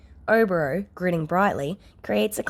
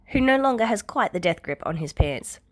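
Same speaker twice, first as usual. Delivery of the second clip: ordinary delivery, nothing marked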